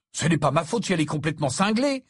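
hurried, heated protest; defensive. Acted. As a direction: fire back in self-defence. Harry defends himself, quarrelling.

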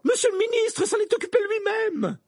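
very high, piercing accusation. A narrator's reading. Professor blames the minister to Dumbledore, piercingly. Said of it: "d'une voix perçante".

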